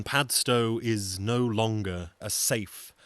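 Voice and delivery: ordinary delivery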